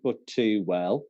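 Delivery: put-on slow Birmingham-accented verse. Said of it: "that you're from Birmingham".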